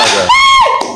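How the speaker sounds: a sudden high, shrieking cackle at the rival; jeering high laugh; mocking shriek laugh. Real, not acted. Mocking the furious opponent.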